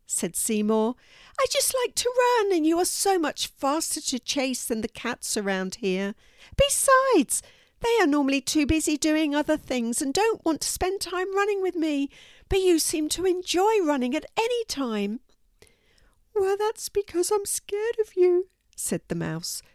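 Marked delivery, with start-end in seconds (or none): 1.4-15.2 high, chatty cat voice; high-pitched cartoon cat; eager cat character. A narrator's reading. Narrator voices the cat Seymour. 16.4-18.5 very high timid squeak; tiny frightened mouse voice; slightly trembling mouse. A narrator's reading. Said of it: "still trembling slightly".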